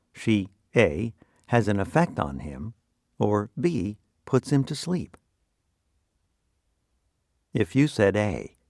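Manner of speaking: ordinary talk, nothing marked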